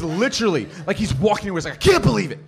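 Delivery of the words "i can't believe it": loud, high mock outrage; hammed-up indignant exclaiming; righteous indignation. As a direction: imitate a kid storming off appalled. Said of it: "so much righteous indignation".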